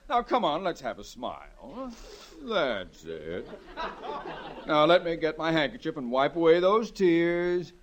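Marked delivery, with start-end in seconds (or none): 0.1-3.5 soft, dropping soothing; husband coaxing his crying wife to smile. Acted. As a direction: coax her gently like a child.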